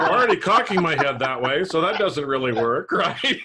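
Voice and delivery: amused at herself, laughing. Real, not acted.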